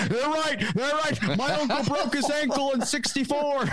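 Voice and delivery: put-on high, cracked old man griping; old-timer voice. Podcast host mocks a know-it-all old man. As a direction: play a creaky old-timer who saw it all. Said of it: "there's some person out there like".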